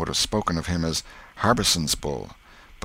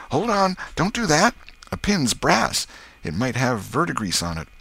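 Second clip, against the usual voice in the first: Tom's urgent warning; 9 semitones higher than usual. Acted. Tom stops Huck using the pin, urgent high voice. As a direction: stop him in a high boyish voice.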